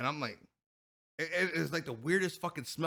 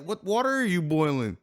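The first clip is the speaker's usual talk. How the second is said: louder incredulous question; 4 semitones higher, 8 dB louder than usual. Retold or imitated. Questioning wife.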